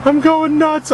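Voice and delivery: high-pitched, worked-up cry; frantic outburst. Real, not acted. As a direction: burst out as if losing it. Repeats it, worked up.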